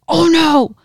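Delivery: high, loud alarmed "oh no"; a panicked yelp of alarm; alarmed cry. Retold or imitated. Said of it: "Joe's like".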